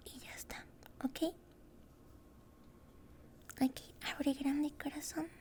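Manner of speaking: soft, breathy close-mic whisper throughout; tender roleplay dentist whispering to a patient; ASMR whisper. Acted. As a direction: whisper gently like a dentist soothing a nervous patient.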